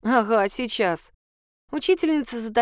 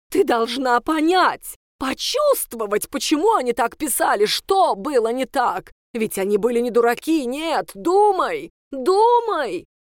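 insistent teacher; 3 semitones higher, 4 dB louder than usual. Acted. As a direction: drill think into her. Teacher urging pupil. (настойчиво, с нажимом)

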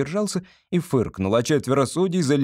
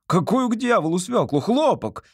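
raised-pitch exasperated outburst; shouting; 9 semitones higher than usual. A narrator's reading. Examinee refused his beetroot, shouting in protest. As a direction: cry out in indignation, pitch jumping up. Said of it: "закричал Мюллер".

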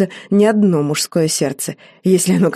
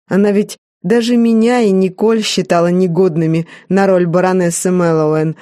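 usual speaking voice

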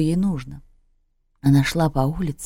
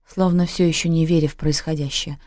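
ordinary delivery, nothing marked

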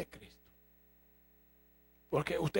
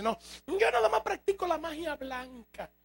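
mocking quote; 9 semitones higher than usual.

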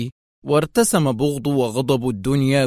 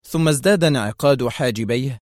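plain reading voice

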